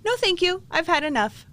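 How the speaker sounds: high, bright and firm, kindly declining; a cheerful high "no thank you"; firm refusal. Shown as a demonstration. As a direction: turn the universe down politely but firmly. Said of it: "So now I say".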